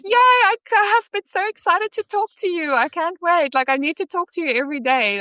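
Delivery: high, gushing impression of an excited mom; beaming mom, imitated. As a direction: gush like a mother thrilled by the call. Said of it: "beaming. Like, every time I call her".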